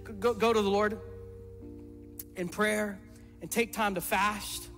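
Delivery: normal talking voice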